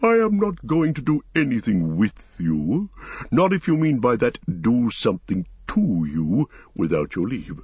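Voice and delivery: ancient tree-creature weighing each clause; measured giant voice with wide, rolling tune; Treebeard, deliberate and independent. Acted. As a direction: ponder aloud, then turn inquisitive.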